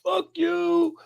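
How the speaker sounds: high mock yell of a street bigot; hostile stranger. Stranger in the street cursing at the speaker, mock yell. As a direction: spit it at him like a furious stranger. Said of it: "He's like".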